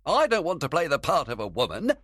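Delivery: high, quick protest; complaining. A narrator's reading. Flute to Quince on being given Thisbe, complaining. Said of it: "Flute complained".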